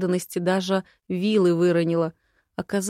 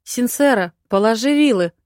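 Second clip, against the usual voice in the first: loud, higher, exasperated order; annoyed lord yelling across the yard; irritated shout; 4 semitones higher, 9 dB louder than usual. A narrator's reading. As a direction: snap at her to drop the pitchfork. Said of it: "раздражённый возглас Харша".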